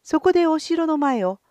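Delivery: usual speaking voice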